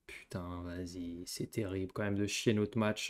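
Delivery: very soft, low muttering; soft disgusted mumble; muttered disgust. Real, not acted. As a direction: grumble to yourself.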